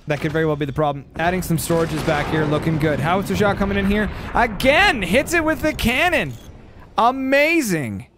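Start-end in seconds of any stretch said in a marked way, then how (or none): 4.3-8.1 high, thrilled commentary; excited play-by-play. Real, not acted. Caster calling another cannon hit, thrilled. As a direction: pitch it up and draw out the praise.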